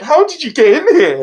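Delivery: high, sing-song pet talk. Retold or imitated. Talking to the cat.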